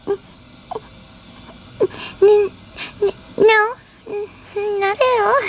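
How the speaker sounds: high, stammering, trembling no; stammering scared denial. Pretend. Doll answering if she's scared, high and stammering. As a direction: pretend to be scared but deny it. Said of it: "Are you scared?".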